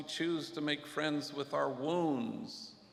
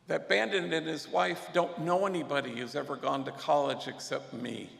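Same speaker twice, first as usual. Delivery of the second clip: ordinary talk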